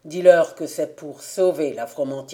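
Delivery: a hint of a stern father sending his girl off; old father. Father instructing Rousille to go fetch Jean-Némi, lightly low and firm.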